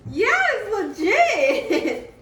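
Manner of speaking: high, loud cheer with a laugh; laughing excited shout; thrilled. Real, not acted. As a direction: crow it in real delight. Thrilled by the new tripod.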